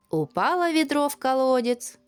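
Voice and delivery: ordinary delivery, nothing marked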